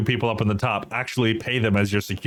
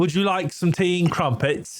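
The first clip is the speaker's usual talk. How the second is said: a higher, hammed-up posh British accent; 6 semitones higher than usual. Shown as a demonstration. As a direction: do a jokey tea-and-crumpets British voice.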